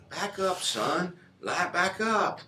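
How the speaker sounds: soft and slow, easing him back; calm him down like a patient father; a hushed, unhurried fatherly urging; gentle fatherly correction. Retold or imitated.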